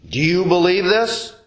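ordinary talk, nothing marked

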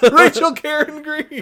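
high, loud triumphant blurt; loud then suddenly hushed. Real, not acted. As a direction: shout the name out in triumph. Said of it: "Sorry, I'm being loud".

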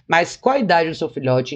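ordinary talk

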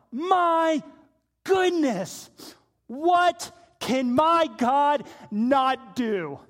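loud, slow, drawn-out marvelling; full-force preacher's amazement; awestruck exclamation. Retold or imitated. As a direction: proclaim it in wonder, stretching each word. Preacher marvels at God's power.

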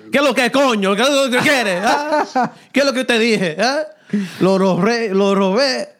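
high, heated Dominican Spanish rant as Dad, laughing; dad yelling at the chimps, mock furious Dominican Spanish; angry Dominican dad. Dad yelling at pissed-off chimps after stealing a base, mock furious Spanish. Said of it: "and he's like".

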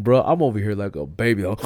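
ordinary talk

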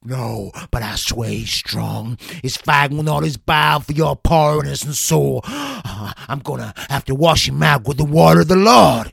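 loud, ecstatic revival preacher; fervent exorcist preacher. Acted. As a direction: roar at the devil, full fervour. Exorcist ritual continues.

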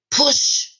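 a high, breathy shout of push; sharp high command. Acted. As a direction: bark it high and urgent. Driver urges her to push.

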